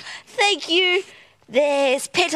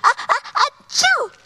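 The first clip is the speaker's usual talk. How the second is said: big sneeze; 8 semitones higher than usual. Acted. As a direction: build up then sneeze hard. Chats sneezes from the flowers.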